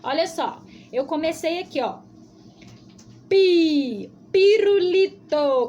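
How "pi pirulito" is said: pirulito stretched slow and loud, syllable by syllable; slow drawn-out word. Shown as a demonstration. Teacher showing children the P sound, drawn out. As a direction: sound out pirulito slowly and clearly for the kids. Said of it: "começa com P".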